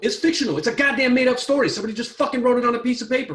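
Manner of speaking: loud, high, heated outburst; angry rant. Real, not acted. Ranting to his co-host that it's only fiction, loud and angry. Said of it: "always makes me mad".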